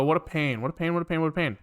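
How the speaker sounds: ordinary talk, nothing marked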